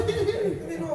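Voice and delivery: usual speaking voice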